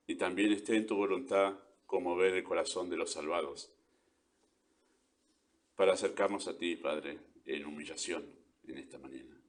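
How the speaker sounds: soft, low prayer voice; hushed, humble address to God with long pauses. Real, not acted. Closing a prayer.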